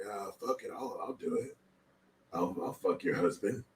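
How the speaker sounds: low, soft, raspy mock politician; Gavin Newsom impression. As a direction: drop low and rasp it out lazily. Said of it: "That's what he sounds like".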